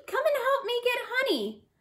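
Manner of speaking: squeaky rabbit voice. A narrator's reading. Rabbit asks Bush Rat.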